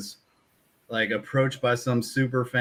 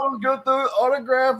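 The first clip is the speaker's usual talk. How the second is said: much higher, airy fanboy gush; eager fan voice; 13 semitones higher than usual. Retold or imitated. Star mimics a fan begging for an autograph, in a high, airy gush. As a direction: squeal it like a starstruck fan.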